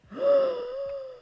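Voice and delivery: high shocked gasp; startled high-pitched gasp; sharp gasp. Real, not acted. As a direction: suck in air in disbelief. Build snapping wrong again.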